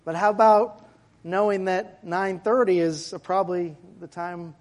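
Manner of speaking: normal talking voice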